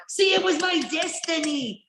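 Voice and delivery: mock-dramatic destiny. Wrestling fan playing up fate for co-host, mock-dramatic. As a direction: declare it like a hammy prophecy. Acting out a fated-moment line.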